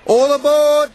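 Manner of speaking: loud, high "All aboard!"; tooting call. A narrator's reading. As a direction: call out like a bus horn. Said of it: "he tooted".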